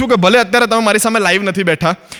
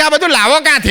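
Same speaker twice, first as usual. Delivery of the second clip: quoted friend; 7 semitones higher than usual. Retold or imitated.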